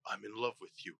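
low, hushed declaration of love; defeated boxer's confession. Acted. Beaten Drago to his love after the loss, low and grave. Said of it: "you say this to her".

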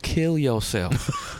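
slow, drawn-out mock disgust; inner retort. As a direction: say it slowly, as a scornful private thought. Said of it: "In my mind, I'm like".

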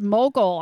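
Mogul said loud and falling; emphatic word. Shown as a demonstration. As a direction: proudly declare Mogul. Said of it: "start using that all the time".

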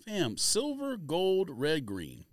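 ordinary delivery

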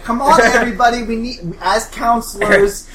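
high, loud, eager rallying; perky camper girl. Acted. Susan rallying the counselors, high and eager. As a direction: cajole the cabin brightly. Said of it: "She's like".

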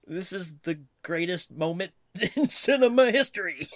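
laughing. Real, not acted. Laughing through fan rave.

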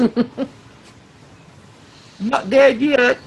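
high, pinched protest; comic dying man. Retold or imitated. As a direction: insist on being alive, squeakily. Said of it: "those scenes in Monty Python's".

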